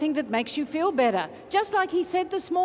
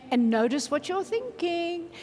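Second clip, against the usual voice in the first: ordinary talk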